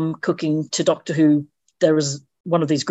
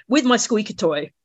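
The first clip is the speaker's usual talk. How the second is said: normal talking voice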